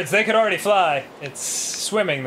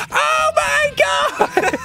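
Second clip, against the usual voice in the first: very high, slow, stretched shriek; hammed-up horror from an audience; mock crowd scream; 3 dB louder, much slower than usual. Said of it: "people are like".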